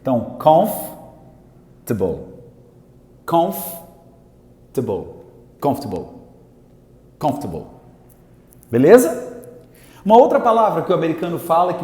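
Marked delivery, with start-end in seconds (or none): 0.4-5.1 "comfortable" slowly as COMF, pause, tbol; slow teaching breakdown of "comfortable", no "or"; broken into parts. Shown as a demonstration. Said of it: "não fala essa sílaba aqui". 5.6-7.7 "Comfortable" as COMF-tbol, low; dropped syllable. Shown as a demonstration. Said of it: "olha como é que a gente fala".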